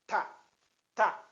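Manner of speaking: "tá" as a brief, separate syllable; short tá. Shown as a demonstration. Teacher repeats the short syllable for learners, brief "tá". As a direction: cut the vowel of "tá" short. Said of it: "Sílaba breve".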